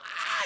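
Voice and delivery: very high mock scream; short high-pitched movie scream; imitated screaming. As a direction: imitate a crowd's panicked scream. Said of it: "People are screaming".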